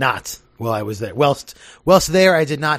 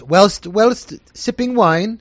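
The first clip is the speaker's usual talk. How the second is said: higher, slow mimicked British accent; mock British accent; 7 semitones higher, 4 dB louder, much slower than usual. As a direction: stretch it out in a teasing British accent. Host mimics guest's British accent.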